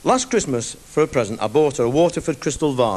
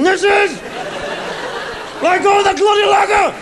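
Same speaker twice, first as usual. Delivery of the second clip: panicked yell. Retold or imitated. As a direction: yell down while hanging off the gutter. Man clinging to shaking ladder.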